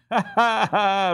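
loud, high laughing ha ha ha; roaring laughter. A narrator's reading. The boys roar at Jacob's expense, laughing loudly. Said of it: "roared all the boys".

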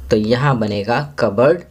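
normal talking voice

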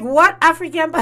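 outraged surprise. Retold or imitated. Shocked reply to boyfriend.

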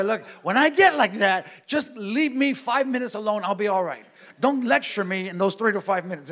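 heated retort. Retold or imitated. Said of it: "I go".

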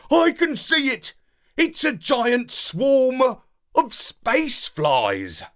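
high, then slow and wondering; excited. A narrator's reading. Dog at the window spotting the swarm, high and excited. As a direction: gasp it out at the window. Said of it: "excited at the portal".

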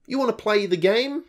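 high teasing sing-song question; mocking taunt. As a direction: taunt the player like the game. Mocking the game's delays.

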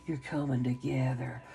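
gentle murmured affirmation; soft echo. Real, not acted. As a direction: let the words fall to a near-hush. Repeated affirmation, softer.

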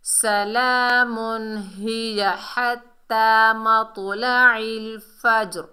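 loud, slow continuous Quran chant; model recitation. Shown as a demonstration. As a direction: recite the whole verse linked, with long-held vowels. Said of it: "ऐसे पढ़ेंगे".

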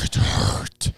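voiceless, strained mock hurt. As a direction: imitate someone wounded, with no voice behind it. Mock wounded quote of Aaron's complaint.

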